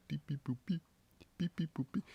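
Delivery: high beeping bass line mimicry; vocal bass line. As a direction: sing the bass notes as beeps. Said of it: "make the bass line just be like".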